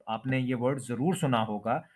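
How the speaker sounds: ordinary talk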